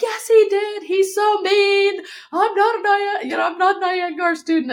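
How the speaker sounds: very high, whiny crying voice; hammed-up tearful protest, words stretched out; mock sobbing wail. Student sobbing to someone comforting her, high mock wail. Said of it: "I was sobbing".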